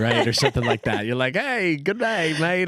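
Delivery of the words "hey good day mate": drawn-out, higher mock Australian greeting; badly faked Australian, stretched and pitched up; bad Australian accent. Shown as a demonstration. American guessing at Outback Steakhouse greeting, terrible Australian accent. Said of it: "that's, that's, like, a terrible, a terrible".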